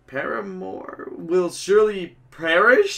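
stagey, mock-horrified narration; theatrical relish, hammed-up woe; melodramatic game show villain. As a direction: declaim it like bad tragic theatre.

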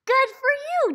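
gasped, high bright cheer of praise; excited praise. Real, not acted. Teacher cheering a young reader, excited and high.

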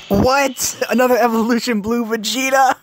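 high-pitched stunned squeal; shocked disbelief. Real, not acted. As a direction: shriek it in disbelief. Shocked at summon result.

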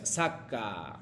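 "Saka" grave y claro, para que repitan; modelo para repetir. Shown as a demonstration. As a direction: di "Saka" despacio como modelo. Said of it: "Otra vez". (modelo de pronunciación para que repitan)

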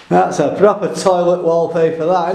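normal talking voice

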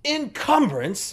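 slow, high, indignant echo; incredulous affronted butler; offended disbelief. Acted. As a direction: repeat the word as if stung. Offended butler repeats the insult.

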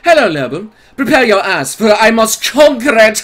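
hammed-up medieval British knight; medieval British accent. Streamer reading a donor's request, medieval British accent. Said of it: "your best b-, medieval British accent".

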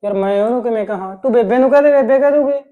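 exasperated husband raising his voice at his wife. Acted. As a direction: push the job off onto her, voice up. Husband brushes off wife's demand.

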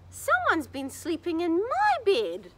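put-on high crying baby bear. A narrator's reading. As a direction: wail it out like a little upset bear. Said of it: "cried Baby Bear".

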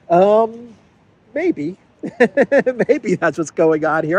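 chuckling through the words, pitch jumping up; amused laugh breaking in; laughing at the absurdity. Real, not acted. Laughing at own joke.

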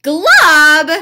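loud high bellow; big loud Glub; booming monster name. Acted. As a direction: announce the swamp creature loudly. Creature's loud entrance.

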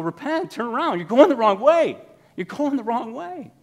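high, loud prophetic call; John the Baptist calling out. Retold or imitated. John the Baptist warning the people, high loud call. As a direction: cry out like a prophet in the wilderness. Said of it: "I see John the Baptist like that".

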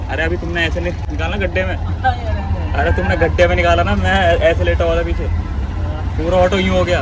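real upset, telling him off for the pothole; scolding the auto driver after his foot got stuck; angry complaint. Real, not acted. Auto jerk hurt his foot.

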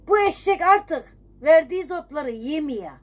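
usual speaking voice

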